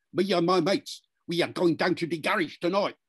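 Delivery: London youth accent, every syllable evenly punched; multicultural London English. Shown as a demonstration. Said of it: "they will say something more like this".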